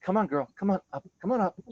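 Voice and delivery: calling the dog up with bacon in hand; coaxing call. Retold or imitated. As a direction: lure the dog up, sweet and high. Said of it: "And I'm like".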